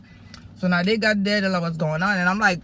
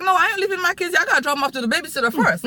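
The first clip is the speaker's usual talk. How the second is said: much higher and louder, fast at the end; adamant refusal; 11 semitones higher, 4 dB louder, faster than usual. Retold or imitated. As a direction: refuse to leave without the kids. Refusing to leave her kids.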